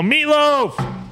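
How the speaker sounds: high, drawn-out holler; mock yell. Showing how to yell for meatloaf, mock yell. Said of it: "You gotta yell".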